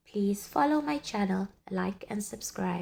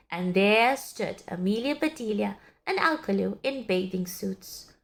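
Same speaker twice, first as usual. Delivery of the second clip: ordinary delivery, nothing marked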